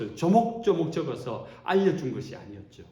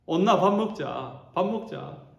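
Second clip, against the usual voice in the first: louder, slow Gyeongsang-dialect call to eat; 5 semitones higher, 4 dB louder, slower than usual. Shown as a demonstration. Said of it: "경상도 버전으로". (경상도 사투리로 크게 부르기)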